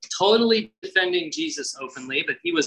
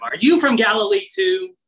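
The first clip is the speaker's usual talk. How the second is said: higher, louder scoffing question; mock him for siding with the Galilean; sneering retort; 9 semitones higher, 5 dB louder than usual. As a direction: Pharisees scoffing at Nicodemus, sneering. Pharisees sneering at Nicodemus.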